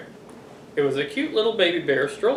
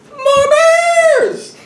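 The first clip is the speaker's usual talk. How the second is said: high, loud shout of more; triumphant cry; 7 dB louder than usual. Acted. Dad shouting the big reveal to the kids, high and loud. Dramatic announcement.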